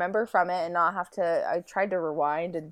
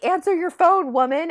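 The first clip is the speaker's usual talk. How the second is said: higher, louder demand; impatient call; 10 semitones higher, 6 dB louder than usual. Retold or imitated. To her stepsister who won't pick up, impatient yell. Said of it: "I was like".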